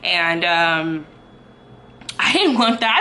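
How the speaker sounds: ordinary talk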